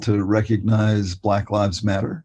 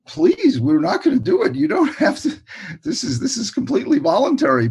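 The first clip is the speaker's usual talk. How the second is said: much higher-pitched, pleading reassurance; high-pitched, imploring quote; urgent reassurance; 13 semitones higher than usual. Retold or imitated. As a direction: retell hurriedly soothing an upset child, pitched way up. Acted reassurance to crying boy.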